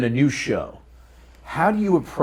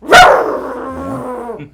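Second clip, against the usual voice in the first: high, loud growling bark; snarling evil bark on request; 12 semitones higher, 8 dB louder than usual. Shown as a demonstration. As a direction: bark like a menacing dog. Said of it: "How about an evil bark?".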